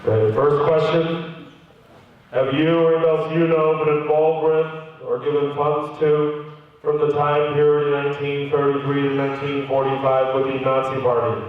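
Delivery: low, monotone official questioning; stern interrogator. Retold or imitated. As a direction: read it out like a grave interrogator. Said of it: "the tone became immediately different".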